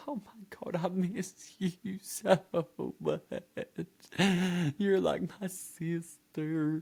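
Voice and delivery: high, drawn-out tearful voice, partly whispered; mock crying. Shelly hugging Anna at the car, mock crying. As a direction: imitate a weepy, high-pitched reunion wail. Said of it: "she's crying".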